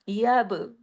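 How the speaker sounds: यब with a small echo after the b; qalqala on b. Shown as a demonstration. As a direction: bounce the closing b of यब. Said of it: "बा पे कलकला". (यब की बा पर कलकला)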